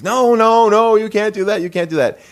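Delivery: high-pitched panicked protest; imitated freak-out. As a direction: imitate a grandmother frantically objecting. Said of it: "she's freaking out about that".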